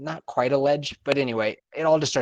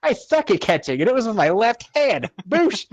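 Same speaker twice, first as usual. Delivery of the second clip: cocky brag; 10 semitones higher, 4 dB louder than usual. Retold or imitated. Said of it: "Fucking epic".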